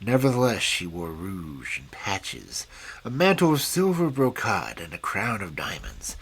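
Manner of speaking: ordinary delivery, nothing marked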